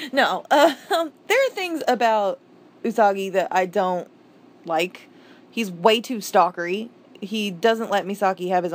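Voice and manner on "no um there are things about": laughing. Real, not acted. Laughing while correcting herself.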